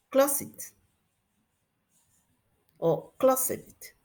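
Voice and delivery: "closet" in two syllables, "clo-sed", for closed; candidate "id" ending. Shown as a demonstration. Teacher offers a tricky option, "closet" with extra syllable. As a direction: add a vowel before the final stop in "closet". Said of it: "cómo se pronuncia el pasado".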